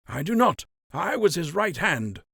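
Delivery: gray buck answering. Acted. Wilfred answering Fry.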